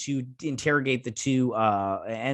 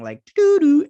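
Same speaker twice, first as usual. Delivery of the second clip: imitated ringtone; 11 dB louder than usual. Vlogger mimics the ringing call in a recap. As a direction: sing a high, loud two-note doo-doo. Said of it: "they get a little, little ring".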